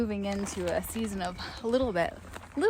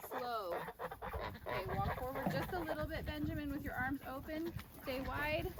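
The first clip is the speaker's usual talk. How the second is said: hushed, gentle calls to animals; soft coaxing voice; 4 semitones higher, 9 dB quieter, faster than usual. Real, not acted. Quietly herding geese.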